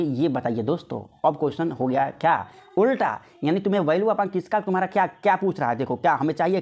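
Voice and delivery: ordinary talk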